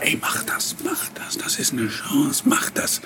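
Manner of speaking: hushed, insistent whisper; urging whisper. Retold or imitated. Friend whispering encouragement before the dubbing job. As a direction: whisper it like a friend egging you on. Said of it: "Und mein Freund sagte immer".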